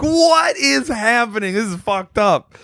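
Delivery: high, drawn-out mock shout; bewildered outcry. Waking from a happy dream, shouting in bewilderment. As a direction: cry out slowly in exaggerated disbelief.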